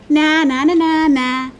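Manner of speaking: mock the squirrels with a snort; cheeky bird jeering na-na; snorting taunt. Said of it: "snorted the flugelbird".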